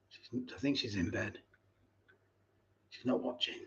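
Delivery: whispered aside. Real, not acted. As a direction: whisper it so the wife won't hear. Whispering about wife.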